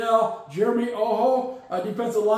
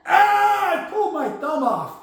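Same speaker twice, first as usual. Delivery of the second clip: mock Winston; 8 semitones higher than usual. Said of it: "Magic tricks like".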